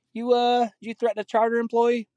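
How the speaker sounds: high-pitched mock cop voice; police officer. Cop questioning a man about a threat, high mock voice. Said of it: "then the cops got called".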